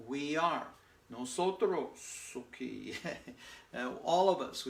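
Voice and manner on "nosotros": "Nosotros" said slowly, each syllable clear; teaching pronunciation. Shown as a demonstration. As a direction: spell out "Nosotros" by ear for the class. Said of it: "Nosotros estamos".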